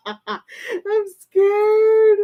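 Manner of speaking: high, drawn-out whiny wail; loud start, slowing into a nervous whine; playfully scared. Real, not acted. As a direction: wail it with giggly dread.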